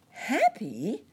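high, loud questioning echo; astonished cry. A narrator's reading. Nurse Jane reacting to the cricket's claim, astonished. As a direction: cry it out in surprise. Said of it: "cried Nurse Jane".

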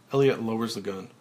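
usual speaking voice

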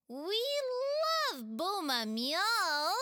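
thrilled at the game's name; squealing with delight. Acted. Chulur delights in Pela's game, squealing. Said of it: "Chulur squealed with delight".

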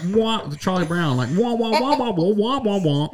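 high, loud wah-wah muted trumpet; Charlie Brown teacher. Retold or imitated. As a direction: imitate the muffled teacher trombone. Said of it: "Teacher talk".

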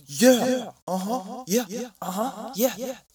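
high, loud mock chest-thumping; teen fighter egging the other on; posturing fighters. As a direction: strut and taunt in a circle.

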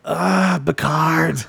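hammed-up, badly faked Scottish; bad Scottish accent. Podcaster showing off bad accents to co-host, mock Scottish. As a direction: have a poor go at a Scottish accent. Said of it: "here's my Scottish accent".

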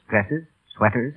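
ordinary talk, nothing marked

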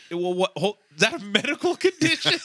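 high, loud, incredulous question through laughter; retold bafflement, squeaking up with laughter; laughing disbelief. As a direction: crack up while acting baffled. Cracking up retelling own baffled reply.

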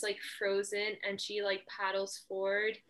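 ordinary talk, nothing marked

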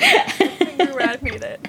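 real laughter breaking into the words; laughing. Real, not acted. Co-host cracking up at the mayor impression, laughing. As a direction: crack up while talking, slowly getting it out.

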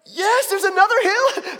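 put-on high, loud, excited shout of a boy; thrilled kid. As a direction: shout it like a kid at the bottom of a hill. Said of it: "He's like".

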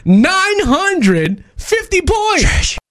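slow, high announcer build-up; milk the suspense like a game show host; drawn-out score announcement. Acted. Co-host announcing the caller's final score, slow and grand.

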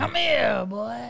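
high, sultry come-here call; mock seductive wife calling out; calling him over. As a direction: beckon him in a high teasing voice.

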